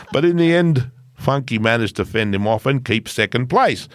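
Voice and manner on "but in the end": ordinary delivery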